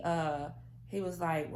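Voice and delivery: ordinary talk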